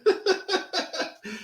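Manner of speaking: short high giggle; a quick embarrassed laugh. Real, not acted. Joking about fake piano background.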